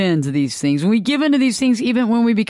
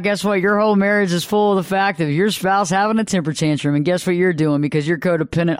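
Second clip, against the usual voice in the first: ordinary delivery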